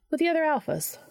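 high-voiced, falling aside; offhand afterthought. A narrator's reading. Charlie adds an afterthought to herself, high-pitched aside. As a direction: add it high, as if to yourself. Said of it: "almost as an afterthought to herself added".

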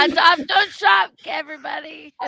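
high, loud rallying shout; shouted slogan. Real, not acted. Shouting the pet-adoption slogan to listeners, high and loud. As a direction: shout it out to everybody like a cause.

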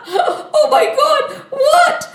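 high, loud acted laughter into disbelieving words; laughing. Vishwamohini shocked at Narad Muni's face, laughing. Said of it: "And then she laughed".

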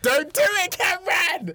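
high, loud dramatic plea; pleading shout. Acted. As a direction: beg him loudly to stop. Begging co-host not to pick.

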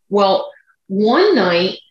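ordinary talk, nothing marked

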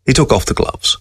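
plain reading voice

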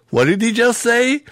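high-pitched mock disbelief; incredulous. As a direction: imitate a stunned young man questioning what he heard. Cousin's stunned reaction.

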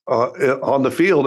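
normal talking voice

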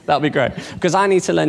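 high-pitched, delighted banter; playfully eager. Real, not acted. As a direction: jump on it with keen, joking interest. Learns listener teaches dance, jokingly pleads.

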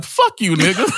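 high, hammed-up cuss back; mock defiant retort. Host mimics the son cussing back at his father, high mock defiance. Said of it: "he was like".

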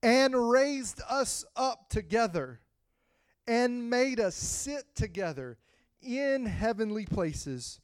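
slow, drawn-out verse reading; slow scripture reading. Acted. Preacher reading Ephesians slowly to the church. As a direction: read it slowly, savoring each phrase.

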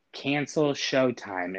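each word said slowly and clearly; slow deliberate command. Retold or imitated. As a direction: spell it out for the robot. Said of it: "I just have to say two words".

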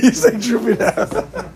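laughing through the words, slow. Real, not acted. Teacher teasing a student's droopy answer, laughing. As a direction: giggle it out as you tease.

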